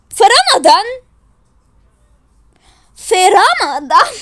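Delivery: high, loud scandalised question; incredulous outburst; shocked disbelief. Acted. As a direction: exclaim it, appalled. Shocked at eating in Ramadan.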